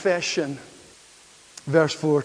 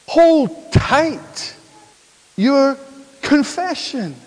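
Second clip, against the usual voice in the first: raised, high preaching voice with weighty pauses; emphatic preaching; 5 semitones higher, 5 dB louder than usual. Real, not acted. Preacher declares the confession to the congregation, emphatic preaching. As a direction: proclaim it to the whole congregation.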